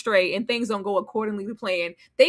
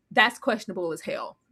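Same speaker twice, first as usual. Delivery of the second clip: normal talking voice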